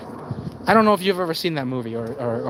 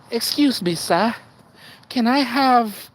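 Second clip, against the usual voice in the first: higher-pitched, pleading request; timid beggar; 7 semitones higher, slower than usual. Acted. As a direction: play someone begging for a buck. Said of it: "somebody asks him for a buck".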